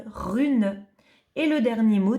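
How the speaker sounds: ordinary talk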